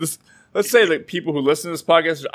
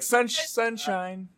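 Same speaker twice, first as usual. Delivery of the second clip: mock cheery sunshine; 9 semitones higher, 7 dB quieter, much slower than usual. Mocking a sunny slogan.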